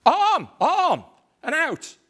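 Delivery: very high, loud rallying shout; battle cry. Acted. Macbeth rousing his soldiers, shouting. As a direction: roar the call to arms.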